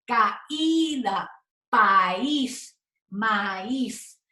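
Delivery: caída, país, maíz split as ca-Í-da, pa-ÍS, ma-ÍZ; strong separate I. Shown as a demonstration. Teacher giving examples of the strong I, vowels held apart. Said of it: "se convirtió en un sonido fuerte".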